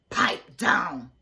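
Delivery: loud, strict witch-like substitute barking orders; a harsh teacher commanding her pupils; mean teacher. A narrator's reading. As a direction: whip the class into shape. Miss Swamp silencing kids.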